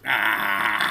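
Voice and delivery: loud angry shout of frustration; frustrated yell. Real, not acted. Ranting at a post, a loud frustrated yell. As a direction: let out a loud fed-up yell.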